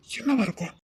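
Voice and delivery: half-whispered inner thought; musing to himself. Acted. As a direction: ask it quietly to yourself. Man wondering to himself.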